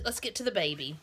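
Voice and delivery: usual speaking voice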